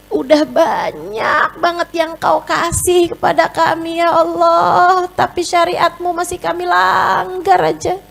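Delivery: high, pleading prayer voice; ashamed prayer. Acted. Preacher acting a woman praying to God in shame. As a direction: beg God, ashamed of yourself. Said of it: "Nangis karena Allah tuh".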